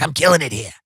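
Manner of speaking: lightly voiced older saleswoman; a hint of a tough sales veteran; Ida. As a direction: imitate an older top seller's brag. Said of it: "That's how she talks".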